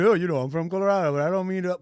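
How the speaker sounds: quick, lightly voiced impression of a commentator trying to stay neutral; a hint of a commentator hedging; imitating the commentator. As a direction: rattle it off like a biased commentator excusing himself. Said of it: "he was trying".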